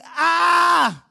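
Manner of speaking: high, shouted mock panic; hammed-up cry of someone about to die. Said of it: "run around in a panic going".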